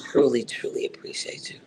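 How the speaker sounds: whispered, teary thanks. Real, not acted. Thanking Conscious at the end, whispered. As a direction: breathe it out through tears.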